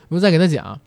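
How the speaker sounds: normal talking voice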